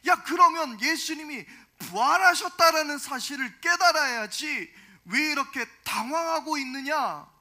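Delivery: preacher acting out an exasperated angel; loud, reproachful mock rebuke; scolding angel. Preacher voices angels scolding the women.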